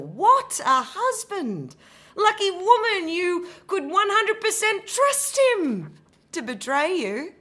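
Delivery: high, loud, scornful mock praise; mocking sarcasm. Betrayed wife mocking her husband, scornful and loud. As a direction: sneer the praise of him.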